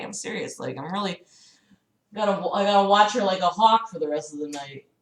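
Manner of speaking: ordinary talk, nothing marked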